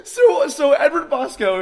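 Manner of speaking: high, loud words through laughter; can barely get it out for laughing; laughing hard. Real, not acted. Telling the cohost about his friend, laughing hard. Laughing after revealing the story.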